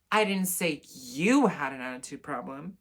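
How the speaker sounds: "you" punched loud, pitch swooping; stress on YOU. Shown as a demonstration. Showing how meaning changes, stress on "you". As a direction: lean hard on the word "you". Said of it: "emphasizing a different word".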